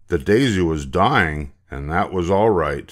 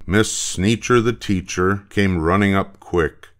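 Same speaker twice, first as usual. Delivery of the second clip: plain reading voice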